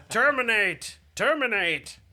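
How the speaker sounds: high, loud robotic repeating command; polite butler robot. Acted. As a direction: bark it like a droid. Butler droid activates, robot voice.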